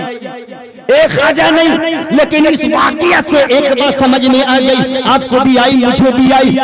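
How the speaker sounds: high, loud impassioned sermon climax; fiery preaching. Acted. Preacher revealing the story's hero to the crowd, shouted. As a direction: thunder the revelation to the hall.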